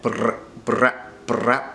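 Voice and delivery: br- buzzed with a trill; br- drawn out as a vibrating r; rolled r. Shown as a demonstration. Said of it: "in the middle is gonna help you".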